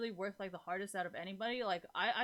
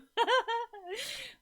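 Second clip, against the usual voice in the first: a burst of high real laughter; 6 dB louder than usual. Real, not acted. Streamer to chat, cracking up with a high laugh. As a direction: laugh out loud, pitched high. Laughs after parenting remark.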